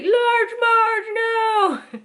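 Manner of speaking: high loud shouted name then no; kid's scared shout. Retold or imitated. Kid on a road trip spotting a semi, scared shout. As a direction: shriek it like a scared kid in the car. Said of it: "you're like".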